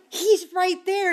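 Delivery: high, loud-edged, drawn-out retold urgency; excited pointing-out. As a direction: stretch it out, high, to an amazed friend. Points star out to amazed volunteer.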